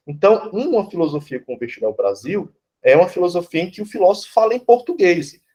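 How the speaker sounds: normal talking voice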